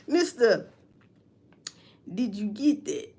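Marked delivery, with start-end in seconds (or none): none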